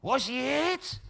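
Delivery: high, loud officious clerk voice; heavenly clerk. Acted. Heaven's gatekeeper brushing off the newcomer at lunch break, high officious voice. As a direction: act the put-out gatekeeper.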